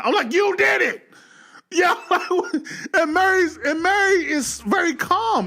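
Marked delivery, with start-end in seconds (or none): 0.3-1.1 excited accusation. Retold or imitated. Accusing Mary's father. 1.7-5.5 laughing. Real, not acted. Laughing while retelling.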